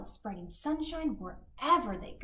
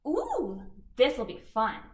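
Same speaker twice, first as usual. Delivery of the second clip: bright grinning delivery, louder; 2 semitones higher, 6 dB louder than usual. Acted. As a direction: grin through the words. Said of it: "she grinned".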